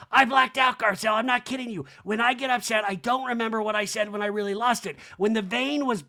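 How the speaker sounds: high, mock-frantic insistence; hammed-up high-pitched excuse; Kyle. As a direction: plead it high, as an impression. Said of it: "Kyle in this scene goes".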